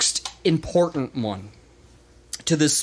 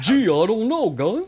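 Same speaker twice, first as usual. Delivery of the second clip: raised, goofy sitcom alien voice; Alf impression; 6 semitones higher than usual. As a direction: do a quick playful Alf. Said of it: "That was my Alf impression".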